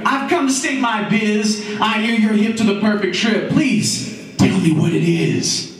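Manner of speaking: high-pitched eager seeker; joyful, eager plea. Acted. Roy asks the guru for the perfect trip, high and eager. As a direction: plead brightly for the secret. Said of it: "says Roy with joy".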